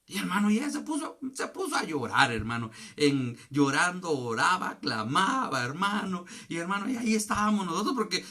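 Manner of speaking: driving, high-volume testimony; full-force preaching. Acted. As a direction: cry it out to the congregation.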